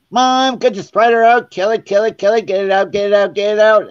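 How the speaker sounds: high, loud mock shrieking for Mom; imitated panicked scream. Retelling sister finding fake spider, high mock screaming. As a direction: act out a rapid, panicked screaming plea. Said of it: "She starts screaming".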